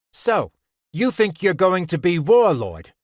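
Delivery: put-on high, loud boy squaring up; challenging demand. A narrator's reading. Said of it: "a boy named Buzz demanded".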